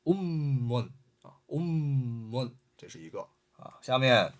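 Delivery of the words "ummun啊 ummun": ummun with the m held long, slowly; teacher drawing out the nasal in ummun; held doubled m. Shown as a demonstration. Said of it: "鼻音啊，mim".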